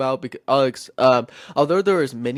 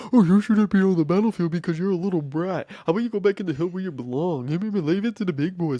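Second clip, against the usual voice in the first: higher, bratty mocking sibling voice; mad older brother; 5 semitones higher than usual. Older brother scolding David on the battlefield, mocking annoyance. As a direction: taunt a kid brother, hammed-up. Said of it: "he was very mad".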